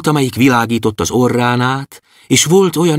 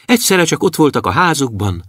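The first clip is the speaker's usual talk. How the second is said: plain reading voice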